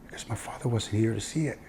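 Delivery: put-on soft retelling of a crying boy's answer; quiet, understated hurt of a child; tearful kid. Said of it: "He was crying".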